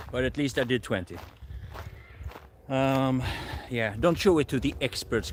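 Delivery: ordinary delivery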